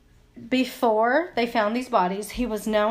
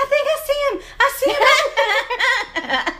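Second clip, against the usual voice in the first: excited; 6 dB louder, slower than usual. Retold or imitated. Retold whispered spotting in parking lot.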